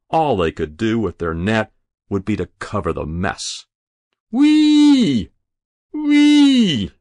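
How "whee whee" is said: long high siren "whee"; screaming like an engine. A narrator's reading. Boy on the roof flapping his arms, screaming like an engine. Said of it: "He began screaming like some kind of engine".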